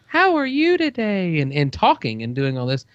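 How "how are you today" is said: high sweet toy voice; cute doll voice. Retold or imitated. Father imitates daughter's teddy bear, high and sweet. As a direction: squeak it out like a talking teddy. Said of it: "then it's like".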